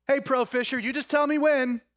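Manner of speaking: loud, high mock deference; sarcastic jab. Peter sarcastically calling Jesus the pro fisher, loud mock deference. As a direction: needle him with fake respect. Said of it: "I sense sarcasm".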